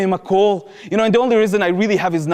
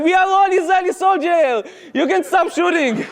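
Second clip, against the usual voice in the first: defiant shout; 9 semitones higher than usual. Retold or imitated. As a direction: shout it as one defiant crowd. Palestinians join the chant.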